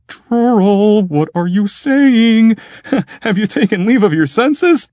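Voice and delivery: very high alarmed cry with nervous laugh; horrified, trying to stop him; panicked. Acted. Clopasius panicking at friend.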